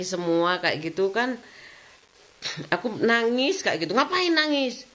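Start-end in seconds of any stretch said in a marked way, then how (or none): 3.9-4.8 mock scolding son. Son scolds crying mother, retold.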